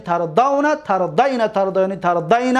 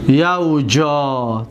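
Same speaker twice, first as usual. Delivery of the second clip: یوجا stretched very slowly, syllable by syllable, low pitch; drawn out slowly; 6 semitones lower, much slower than usual. Shown as a demonstration. Teacher to students, drawing out یوجا very slowly. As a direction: spell out یوجا slowly for the class, lower and unhurried.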